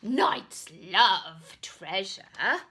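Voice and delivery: loud, slow gleeful relish; delighted knight. Acted. Knight excited about treasure, loud and slow. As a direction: savour the word treasure.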